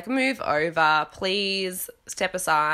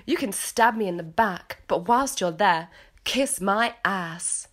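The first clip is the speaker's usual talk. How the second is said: put-on English accent; British accent. Host redoing Lisa's tagline to make it rhyme, British accent. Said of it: "if you say it British".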